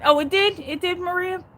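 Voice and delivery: high, loud "oh, it did?"; surprised. Real, not acted. Streamer hearing from a viewer the case got dropped, surprised and high. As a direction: caught off guard by the news.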